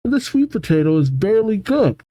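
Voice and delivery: put-on high, fast indignant girl voice; Crystal voice. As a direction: squeak out shocked offense. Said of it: "Crystal says".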